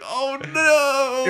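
high, loud drawn-out wail; hammed-up high-pitched dismay; mock dismay. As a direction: cry out in exaggerated alarm. Retelling dismay as friend bawled.

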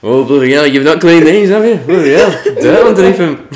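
very high, loud mock disgust through laughter; Paul Scholes impression. As Paul Scholes inspecting his daughter's dirty toenails, high loud mock disgust. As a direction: exclaim it up high and loud, cracking up. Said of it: "great Paul Scholes impression".

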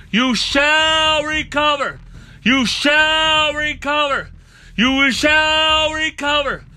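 high, loud, drawn-out repeated cry; full-force healing declaration. Real, not acted. Preacher declaring healing over the sick, shouted slow chant. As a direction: proclaim it slowly at full voice, stretching each phrase.

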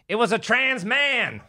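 high, stretched-out mock exclamation; comedian's punchline quote. Host quotes a tackled comedian saving the moment, high exclamation. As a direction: draw it out high like a stage punchline. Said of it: "He goes".

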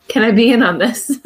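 usual speaking voice

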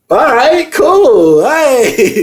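loud high-pitched whoop; hyped-up rush. Retold or imitated. Acting out the rush after cocaine.

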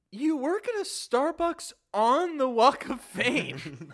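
loud, high, slow incredulous outburst; exasperated retort. Retold or imitated. Mock outburst at cashier's silly question, exasperated. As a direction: hit each word loudly in disbelief.

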